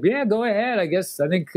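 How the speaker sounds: mock shy old lady, lifted high; a hesitant, slightly high grandma; unsure grandma. Grandmother answering a stranger, hesitant and high. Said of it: "And she's like".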